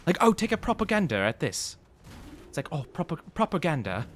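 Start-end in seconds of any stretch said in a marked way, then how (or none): 0.2-1.8 playful imitation of a British accent; a light British accent, joking; mock British accent. Streamer mimics British speakers for chat, in a mock British accent. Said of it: "people with a British accent". 2.7-4.1 mock British accent. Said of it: "people with a British accent".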